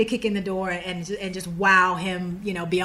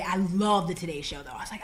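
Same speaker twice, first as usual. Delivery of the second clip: ordinary delivery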